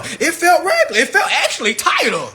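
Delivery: high, squeaky, laughing voice; laughing mock answer. Boyfriend answering his homeboy, laughing high and squeaky. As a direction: answer through giggles like a fool who got played.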